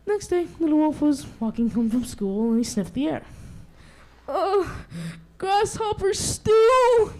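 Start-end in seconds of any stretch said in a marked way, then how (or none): 4.3-7.1 high, slow disgusted moan; disgusted groan. Acted. Little wolf smelling dinner, slow disgusted groan. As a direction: drag it out in disgust. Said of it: "he groaned".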